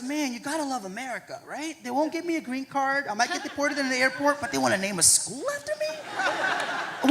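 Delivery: half-laughing, rising incredulous aside; amused disbelief. Retold or imitated. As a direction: laugh at the irony as you think it. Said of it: "all I could think about was".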